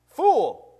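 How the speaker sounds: sharp, loud and high condemnation; stern rebuke. Acted. God rebuking the rich man, loud and high. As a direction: thunder it down like divine judgment.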